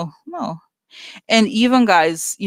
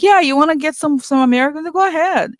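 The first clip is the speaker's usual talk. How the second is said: much higher, louder eager-salesman mimicry; mocking Zuckerberg; 8 semitones higher, 4 dB louder than usual. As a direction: play a smug tech boss happily handing over Americans.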